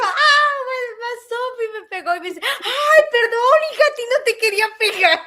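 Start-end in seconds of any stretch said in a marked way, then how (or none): 0.0-2.1 high, loud wail breaking into laughter; hammed-up cry of a child who got whacked; mock child wail. Child hit by grandma's cane, mock wailing. 2.4-5.2 high, loud mock-dismayed old woman; imitated apologetic grandma. Grandma to the granddaughter she hit by mistake, loud high apology.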